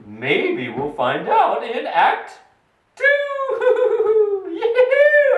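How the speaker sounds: ordinary delivery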